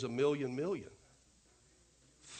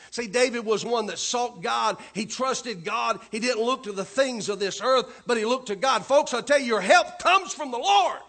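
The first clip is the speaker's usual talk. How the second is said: louder, higher climax of the sermon; full-force preaching; 6 semitones higher, 9 dB louder than usual. Acted. Pastor urging folks to trust God, full-force preaching. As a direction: lift it to a ringing climax.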